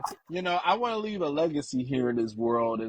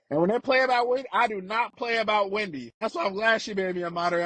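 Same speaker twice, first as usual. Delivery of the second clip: usual speaking voice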